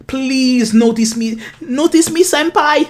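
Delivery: high, slow, pleading fan voice; excited anime fan. Pretend. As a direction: beg senpai to notice you. Mock anime fan begging senpai. (fan d'anime surexcité)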